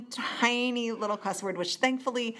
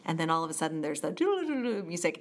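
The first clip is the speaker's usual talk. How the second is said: usual speaking voice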